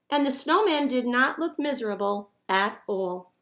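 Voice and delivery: usual speaking voice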